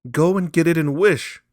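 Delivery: high, loud trembling cry; old woman crying to her husband; quivering excitement. A narrator's reading. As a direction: shake with eager urgency. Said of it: "cried the old woman, quivering with excitement".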